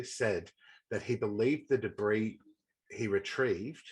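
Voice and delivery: ordinary talk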